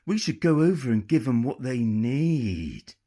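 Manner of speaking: pirate suggesting they board, slow and leering. A narrator's reading. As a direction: savour each word like a lecher.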